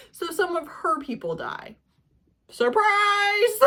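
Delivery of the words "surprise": higher and louder mock reveal; mock surprise; sarcastic surprise. Real, not acted. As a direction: fake astonishment, dripping sarcasm. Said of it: "sarcastic reveal".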